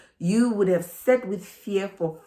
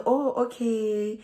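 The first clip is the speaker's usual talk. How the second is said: long drawn-out "oh, okay"; slow, musing moment of self-discovery; dawning realisation; 4 semitones higher, slower than usual. Acted. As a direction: let the realisation sink in slowly. Voicing own inner realisation.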